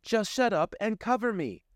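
high, snappy command; curt order. Acted. Amanda orders Blaze to cover her, high and curt. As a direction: cut him off. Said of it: "Amanda said".

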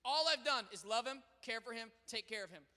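usual speaking voice

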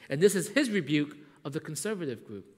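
normal talking voice

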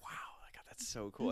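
awed, breathless whisper of a dazzled fan; starry-eyed whisper. Pretend. Host imitating dazzled young animators in an awed whisper. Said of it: "just like".

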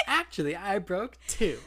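waitress proudly admits broken dishes; proud silly voice. Retold or imitated. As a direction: brag proudly about the breakage. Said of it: "She's like".

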